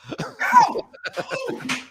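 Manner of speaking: high shrieking laughter. Real, not acted. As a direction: howl with laughter. Cackling after squirrel bit.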